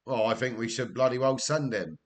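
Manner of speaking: normal talking voice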